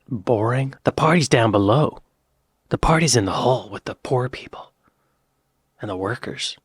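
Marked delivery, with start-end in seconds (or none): 2.7-6.6 conspiratorial whisper. Pretend. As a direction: whisper it like a secret. Describing the party below deck.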